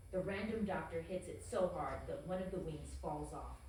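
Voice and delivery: normal talking voice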